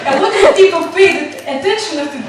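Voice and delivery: loud, fast words through laughter; laughing. Real, not acted. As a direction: laugh while teasing back. Teacher teased, answers laughing.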